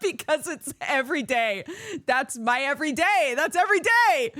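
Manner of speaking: laughing emphatic. Real, not acted. Laughing agreement, getting louder.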